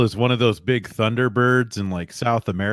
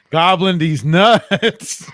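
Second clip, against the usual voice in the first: high, loud, stretched-out joke reveal; gleeful punchline; 8 semitones higher, 7 dB louder, much slower than usual. Acted. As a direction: drag out the crude punchline, laughing. Acts out the punchline introduction.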